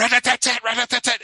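high, loud rat-a-tat gun sound; gunfire noise. Retold or imitated. Recruit shooting the sergeant, mimicked as loud rapid gunfire. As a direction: spit out a machine-gun burst. Imitating gunfire in retold scene.

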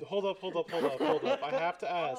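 breaking into laughter mid-sentence; loud, high, giggling disbelief; laughing excitedly. Real, not acted. Laughing disbelief at Biden topic.

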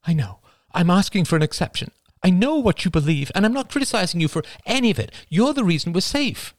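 mother rushing to plead; words in a rush. A narrator's reading. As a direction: rush it out, begging. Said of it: "words came in a rush".

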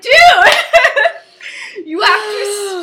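high, loud, very slow, cracking up; laughing mock lecture; laughing scolding. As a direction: scold him while losing it to laughter. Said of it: "She's like".

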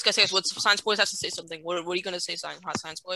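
normal talking voice

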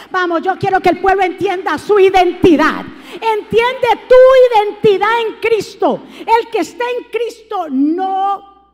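predicando a plena voz, más alto y fuerte; exhortación encendida a la congregación; predicación. Acted. Preaching with laugh. (predicador gritando con fervor)

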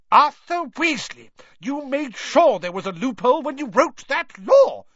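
high, loud furious shout. Acted. Molly shouting at Arthur over the law, loud and high. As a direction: let him have it. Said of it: "shouted Missus Weasley".

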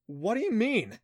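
usual speaking voice

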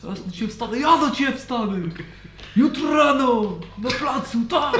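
high, loud challenge to a duel; angry shout through wall. Acted. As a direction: yell it at the neighbour's door. GM voices guests yelling through walls.

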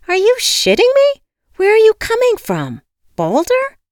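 very high, loud incredulous questions; outraged disbelief. A narrator's reading. Locked-out caller berates the receptionist, outraged. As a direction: shriek each question in indignant disbelief.

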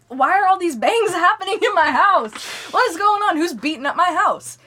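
high, loud rant breaking into laughter; hammed-up indignant hollering; mock outraged yelling. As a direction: shout it in comic disbelief. Mock outrage over the bangs.

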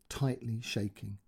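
ordinary delivery

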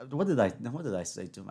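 ordinary delivery

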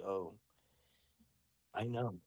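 lightly imitated knowing child witch; daughter in character. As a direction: answer with understated gravity, pausing midway. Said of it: "she's talking just like this".

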